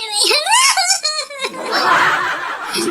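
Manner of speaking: high, soft stunned laugh; a disbelieving falling laugh; mother laughing in disbelief; shocked laugh. Acted.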